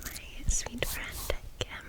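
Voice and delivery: normal talking voice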